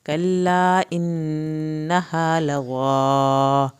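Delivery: slow, loud, drawn-out tajweed chant; Quran recitation. Shown as a demonstration. Teacher recites aloud for students, slow loud chant. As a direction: chant each word slowly for the class to follow. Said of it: "زا کی شد ادا کی جائے گی".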